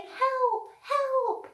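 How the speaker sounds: high, small voice calling help; a little trapped animal crying out for help. Retold or imitated. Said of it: "there was another animal saying".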